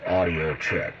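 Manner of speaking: a radio test call heard on the bench radio; heard over a radio. Real, not acted. Ham operator's test call relayed through the receiving radio speaker. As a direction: voice coming back through a CB speaker. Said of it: "You can hear the radio coming across the test bench radio".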